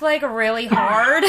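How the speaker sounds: loud, slow laugh through words. Real, not acted. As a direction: laugh out the telling. Real laugh while retelling.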